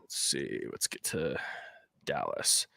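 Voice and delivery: soft low whisper, echoing the other; whispered to self. Real, not acted. Second host echoes while hunting the line, low whisper. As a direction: murmur it back under your breath.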